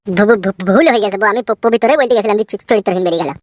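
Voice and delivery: stumbling, stuttering apology; flustered stammer. Acted. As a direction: trip over the first sounds in panic. Son-in-law flustered after slip. (বউয়ের কাছে ভুল স্বীকার, তোতলানো গলায়)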